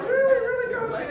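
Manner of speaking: high, loud squeaky athlete voice; incredulous young athlete whining at the coach; mock disbelief. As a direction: play the offended kid, way up high. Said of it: "They're like".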